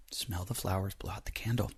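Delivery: soft, whispered calming advice; soothing whisper. Pretend. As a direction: breathe it out gently like a calming coach. Calming listeners with breathing advice.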